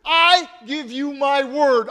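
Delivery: loud, angry oath; cursing. A narrator's reading. Peter cursing at the bystanders, loud and angry. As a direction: spit out the curse. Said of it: "Peter began to curse".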